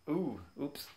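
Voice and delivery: usual speaking voice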